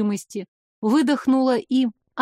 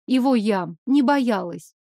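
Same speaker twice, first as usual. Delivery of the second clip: usual speaking voice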